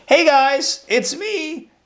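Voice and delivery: high, loud cheery "hey it's me"; friendly reveal. Pretend. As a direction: pop out as the long-lost brother revealing himself.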